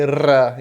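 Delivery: "ra" with a trilled tongue-tip R; rolled R. Shown as a demonstration. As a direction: roll the R that opens "ra". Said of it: "esse som de ra".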